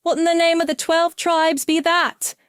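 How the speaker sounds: a high astonished cry; old trumpet hustler astonished. A narrator's reading. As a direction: blurt it out, amazed at the sight. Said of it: "cried in astonishment".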